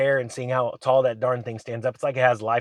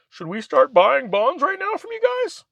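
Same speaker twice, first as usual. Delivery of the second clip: much higher, sheepish put-on asking; mock Treasury; 13 semitones higher, 4 dB louder than usual. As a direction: ask like a meek beggar. Treasury asking banks to sell bonds.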